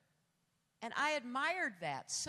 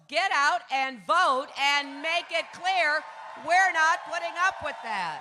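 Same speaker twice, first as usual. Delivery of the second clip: rallying shout; 4 semitones higher, 13 dB louder than usual. Real, not acted. Candidate urging the rally crowd to vote, shouting. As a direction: roar it to the crowd so the back row hears.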